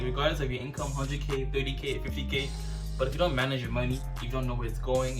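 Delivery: ordinary delivery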